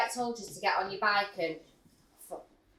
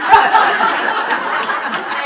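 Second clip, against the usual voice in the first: loud high laugh; laughing at the word; loud laughter; 11 semitones higher, 14 dB louder than usual. Real, not acted. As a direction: burst out laughing.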